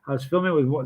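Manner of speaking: ordinary delivery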